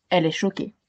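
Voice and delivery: ordinary delivery, nothing marked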